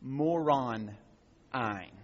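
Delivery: "Moron" then "Ein", split and slow; said slowly. Shown as a demonstration. Teacher sounding out the Greek root slowly. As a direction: break "Moron. Ein." into slow, separate parts. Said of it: "I'm gonna say it slowly".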